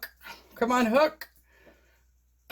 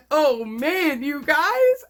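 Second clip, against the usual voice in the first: much higher and louder, near tears; overwhelmed awe; 7 semitones higher, 6 dB louder, much slower than usual. Real, not acted. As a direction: voice cracking with delight.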